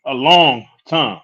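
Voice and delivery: slow, weighty stress on "long time"; drawn-out emphasis. Real, not acted. Stressing how long they were together.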